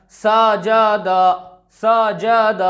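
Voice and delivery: सजद dragged out as saa-jaa-daa; slow, drawn-out सजद; wrongly stretched vowels. Shown as a demonstration. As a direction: hold every vowel of सजद long. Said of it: "इसका गलत तरीका".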